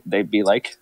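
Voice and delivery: ordinary talk, nothing marked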